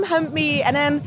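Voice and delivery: normal talking voice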